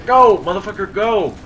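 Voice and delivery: high, loud frantic yelling; being chased. Real, not acted. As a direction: urge him on, panicked.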